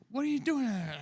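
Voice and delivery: high mock guard. Guard catching them, mock high voice.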